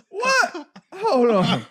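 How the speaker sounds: high-pitched laughing astonishment; retold disbelief. Teammates hearing the TV interview, high incredulous laughing reaction. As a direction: squeak it out through laughter, stunned.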